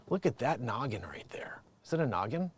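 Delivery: soft whispered aside. Real, not acted. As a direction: whisper it conspiratorially.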